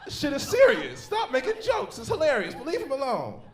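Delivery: high, loud plea to stop the jokes; hammed-up outrage, high and loud; mock pleading shout. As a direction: yell at the crowd to leave him alone, laughing. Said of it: "It's like".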